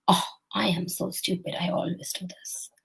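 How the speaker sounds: soft, sinking self-blame, fading to a whisper; self-shaming sigh. Shown as a demonstration. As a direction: beat yourself up quietly, trailing into a whisper. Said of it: "Instead of saying".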